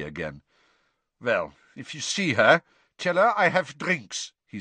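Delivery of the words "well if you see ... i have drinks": put-on surly, thick foreign accent; reply curtly in a foreign accent; halting, foreign-accented instruction; Krum's foreign accent. Krum, surly foreign student, replies.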